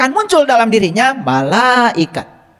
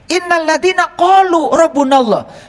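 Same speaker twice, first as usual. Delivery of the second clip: slow Quranic recitation; 6 semitones higher, slower than usual. Acted. Reciting Quran verse in sermon.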